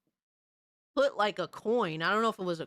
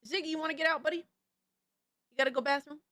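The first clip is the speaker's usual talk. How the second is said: sweet question to a pet; pet-talk voice; 4 semitones higher than usual. Real, not acted. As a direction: coax the dog in a higher voice. Talking to the pet dog.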